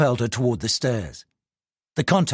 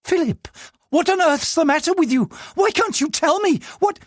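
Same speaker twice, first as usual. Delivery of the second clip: higher, worried wife's pleading; anxious pleading. Acted. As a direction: beg him to explain, scared. Frightened wife pleading.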